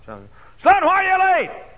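loud, high scolding shout; scolding mother. Retold or imitated. Mother scolding her late son, loud angry shout. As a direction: demand an answer like an angry mother.

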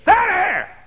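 put-on sharp high yell of an angry old lady; shouted command. Old lady yelling at thieving boys, loud shout. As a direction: bark it out like she caught them stealing.

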